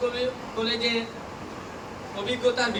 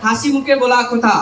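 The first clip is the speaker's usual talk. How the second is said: loud, measured public recitation of verse; poem recitation; 2 semitones higher, 7 dB louder than usual. Acted. Trainee reciting a farewell poem to college teachers, declaimed loudly. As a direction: declaim rhymed tributes line by line, pausing between phrases.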